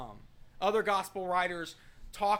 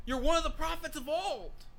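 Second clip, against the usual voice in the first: much higher, swooping call; people's lofty claim; 9 semitones higher than usual. Acted. People giving Jesus a lofty title, high-pitched claim. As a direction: hand out a grand title. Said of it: "that's what people are saying".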